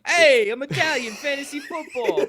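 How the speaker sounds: high, loud mock Midwestern Italian accent; Italian impression. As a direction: ham up a Midwestern take on an Italian guy. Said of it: "That's my impression of you".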